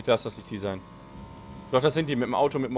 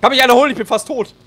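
panicked plea; 8 semitones higher, 6 dB louder than usual. Real, not acted. Teammate downed, begging for help.

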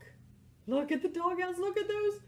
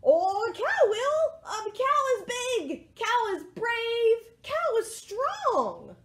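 high, slow, loud chant of praise; rousing group cheer; 8 semitones higher, 4 dB louder, slower than usual. Acted. Animals volunteering cow for the job, high rousing cheer. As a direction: proclaim each phrase high and drawn out.

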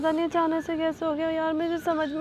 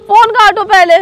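much louder faked demand; pretend frantic shout; 4 semitones higher, 16 dB louder than usual. Girlfriend yells at boyfriend to cut the call, acted panic. Yelling at him to hang up.

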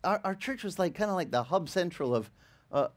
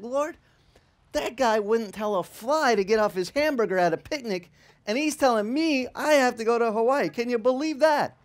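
higher, louder incredulous complaint; indignant prayer; 5 semitones higher, 5 dB louder than usual. Retold or imitated. Pastor complaining to the Lord about the messenger, indignant. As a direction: protest to heaven in disbelief.

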